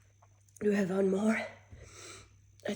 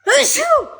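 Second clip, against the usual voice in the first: explosive high sneeze; one huge sneeze; loud sneeze; 16 dB louder than usual. Real, not acted. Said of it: "this almost got me out of the chair".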